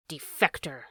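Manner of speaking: low, scornful jab; low, contemptuous accusation; spat. A narrator's reading. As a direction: spit out the insult. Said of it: "spat the polecat".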